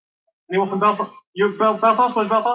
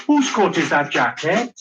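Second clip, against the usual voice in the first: slow, confused Welsh lilt; Welsh accent; slower than usual. Shown as a demonstration. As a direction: lead the room in baffled Welsh. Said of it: "do a good Welsh accent".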